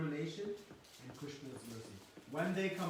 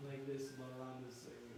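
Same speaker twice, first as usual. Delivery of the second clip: ordinary delivery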